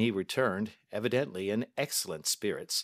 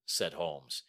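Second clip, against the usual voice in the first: ordinary delivery, nothing marked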